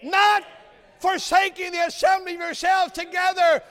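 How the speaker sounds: high, loud preaching crescendo; full-force preaching. Acted. Preacher urging the church to assemble, shouting the verse. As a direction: thunder the verse at the congregation.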